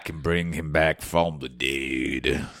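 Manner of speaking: Dark Magic Alan. Acted. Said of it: "I'm Dark Magic Alan".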